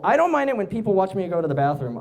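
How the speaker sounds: ordinary talk, nothing marked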